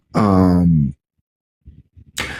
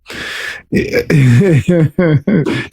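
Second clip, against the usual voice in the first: amused laughing in short bursts; real chuckle. Real, not acted. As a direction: let out a quick run of chuckles. Host amused by co-host talk.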